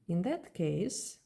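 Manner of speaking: usual speaking voice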